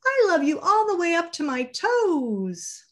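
upside down, high and playful; little hare shows off, very high. Acted. Little hare upside down on the tree, high playful voice.

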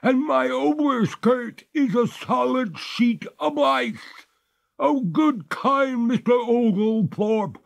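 very high, slow, despairing ghost; despairing cry. A narrator's reading. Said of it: "cried the ghost in despair".

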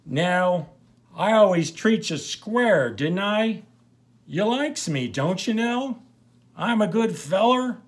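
put-on high, loud drunken pleading; drunk Pete pleading. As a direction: beg Nell to say you're good. Drunk Pete begging Nell.

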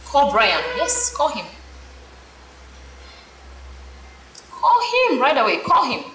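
loud repeated urging; insistent demand. Acted. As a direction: order her to call him now. Friend pushing her to call.

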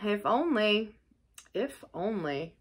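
trailing, doubtful aside; dubious. A narrator's reading. Rat eyeing Toad's sorrowful face, dubious. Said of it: "observed the rat dubiously".

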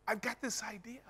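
high, soft eager pitch of an idea. Retold or imitated. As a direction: bubble over with a new idea.

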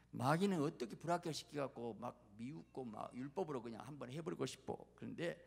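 normal talking voice

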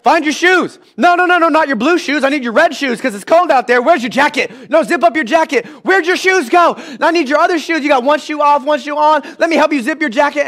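put-on exasperated parent rushing a kid; harried parent voice. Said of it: "that's just me getting Tiffany ready".

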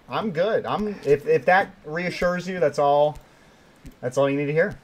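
ordinary talk, nothing marked

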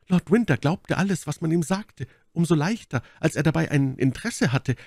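high, urgent lady's voice; Milady, eindringlich klagend. Acted. Milady tells her story. (Mylady, hohe klagende Frauenstimme)

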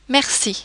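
merci with the C as an S sound; soft C. Shown as a demonstration. Teacher demonstrating French letters, merci with soft C. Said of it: "a soft C, almost like an S".

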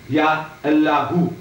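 Ya Allahu slowly, with Ya set apart; slow demonstration. Shown as a demonstration. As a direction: say Ya Allahu deliberately, holding the double L. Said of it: "This is his name".

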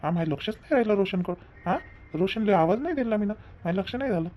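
husband sheepishly admitting he forgot her brother; sheepish apology. Acted. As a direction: own up quietly, ashamed.

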